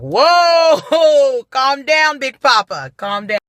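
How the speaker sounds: high, loud whoa settling into calm down; laughing, nervous calm-down; startled placating. Retold or imitated. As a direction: soothe the angry old man, caught off guard. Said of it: "I'm like".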